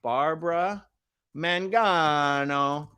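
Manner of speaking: Mangano drawn out syllable by syllable; slow careful name. Shown as a demonstration. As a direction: spell out Mangano slowly. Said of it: "let me make a note of this".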